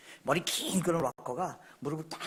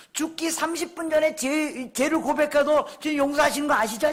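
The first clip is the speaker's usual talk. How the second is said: high, emphatic pulpit voice; forceful preaching; 8 semitones higher, 7 dB louder than usual. Real, not acted. Preacher urging congregation toward holiness, forceful preaching. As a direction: drive it home to the congregation.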